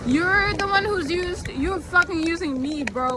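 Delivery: high, mocking comeback; indignant retort. Vlogger retorting to the ex's status, indignant and high.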